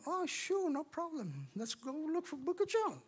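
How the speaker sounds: high, soft mock agreeableness; feigned compliance. Newcomer searching his Bible for John, high, soft compliance. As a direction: play along sweetly in a small high voice. Plays along, searching for the book.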